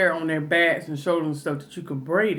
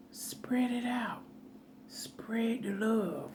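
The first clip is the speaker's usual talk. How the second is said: whisper; 5 semitones higher, 11 dB quieter, much slower than usual. Real, not acted. Aside about sandwich meat.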